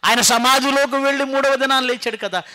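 high, loud insistence; emphatic preaching. Real, not acted. Preacher reminding people he rose, high emphatic voice. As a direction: hammer the point home at full voice. Preacher drives home the resurrection.

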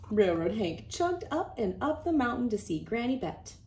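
ordinary delivery, nothing marked

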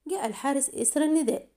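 ordinary delivery, nothing marked

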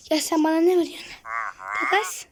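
a loud, rapid, offended rant; indignant outburst. Real, not acted. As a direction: complain hotly at the trader. Outraged at a villager trade.

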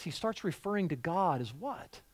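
normal talking voice

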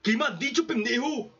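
high, offended mock villain voice; Moria's indignant reply. Moria answers Kuma's insult, high offended mock voice. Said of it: "Y Moria".

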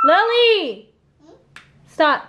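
loud, high stern call of the name; sharp warning call. Real, not acted. Mother scolds toddler mouthing toys.

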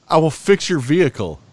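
hammed-up echo of the mechanic's deal; mock shop owner. Mimics the shop owner's offer.

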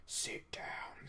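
very quiet, whispered ghost; ghostly voice. Acted. Ghost speaking to the newly woken hero, ghostly whisper. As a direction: whisper it like a phantom. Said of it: "said a ghostly voice".

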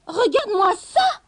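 loud, high angry cry; furious shout. A narrator's reading. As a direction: yell at your husband in fury. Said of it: "cria-t-elle furieuse".

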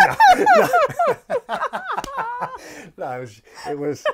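loud, high words through laughter; laughing correction. Real, not acted. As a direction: correct yourself while still laughing. Correcting the YouPorn slip.

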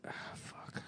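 under-breath whisper; whispered curse. Real, not acted. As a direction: mutter it to yourself. Muttering to self.

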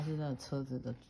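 ordinary delivery

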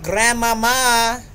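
long drawn-out call for grandma; slow, high, loud call for Grandmama. Real, not acted. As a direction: stretch it out, calling across the room. Calling out to grandma in game.

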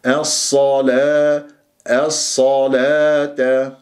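الصلاة chanted slowly, heavy open sad, lips unrounded. Shown as a demonstration. Teacher models the word for students, slow recitation. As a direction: draw out the vowels in الصلاة. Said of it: "لا تكور الشفاه".

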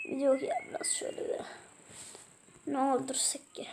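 whispered aside. Real, not acted. Gamer muttering to himself, whispering. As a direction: hush it like thinking aloud. Quiet aside while playing.

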